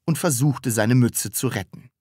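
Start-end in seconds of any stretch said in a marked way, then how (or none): none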